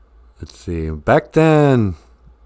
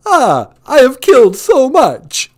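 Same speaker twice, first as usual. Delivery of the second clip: much higher, louder gloating vampire count; 13 semitones higher, 8 dB louder, slower than usual. Retold or imitated. Host voicing the Count boasting about his kills, gleeful and loud. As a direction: play the Count crowing over his victims. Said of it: "you keep forgetting that Count is a, uh, vampire".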